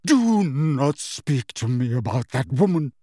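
slow, emphatic, dramatic ban; wounded melodramatic outburst; outraged cry. A narrator's reading. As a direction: explode with theatrical affront, stretching the words. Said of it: "cried Professor Trelawney".

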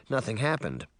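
ordinary delivery, nothing marked

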